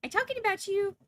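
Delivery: high, loud squeaky pet voice, rising further; cat's voice. Pretend. Owner voicing her nosy cat, high and loud. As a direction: voice the cat calling out from her rug. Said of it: "And look, she's".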